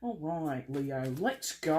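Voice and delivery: put-on drawn-out, playful Aussie accent; Australian accent. Reader rallying Leo to start, playful Australian accent. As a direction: slip into a mock Australian accent. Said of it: "apparently I was headed to Australia".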